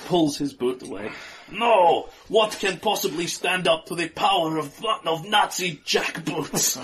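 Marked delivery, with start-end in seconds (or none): 1.5-6.8 outraged villain. Acted.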